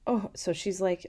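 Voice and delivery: usual speaking voice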